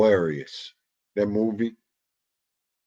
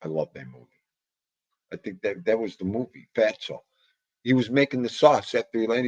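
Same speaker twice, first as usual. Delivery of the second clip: ordinary delivery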